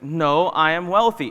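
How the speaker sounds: loud, firm self-insistence; insistent affirmation. Acted. Answering your own doubting mind, insistent and loud. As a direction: declare it over the doubter, loudly. Arguing with inner voice.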